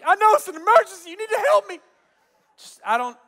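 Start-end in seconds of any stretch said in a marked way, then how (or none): 0.0-1.8 higher, louder, fast insistence; frantic reply. Retold or imitated. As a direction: argue with the operator in panic. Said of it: "Lady's like".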